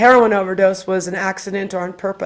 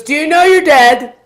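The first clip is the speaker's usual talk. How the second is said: put-on high, loud little-kid question; cute child; 7 semitones higher, 7 dB louder than usual. Child on the tour questions the spirit box, high and cute. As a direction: ask it like a bold, innocent child. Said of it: "he was so cute".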